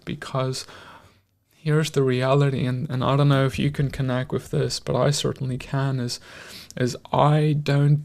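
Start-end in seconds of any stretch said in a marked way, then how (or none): none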